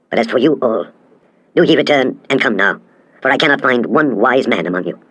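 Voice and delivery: usual speaking voice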